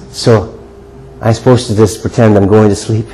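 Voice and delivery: normal talking voice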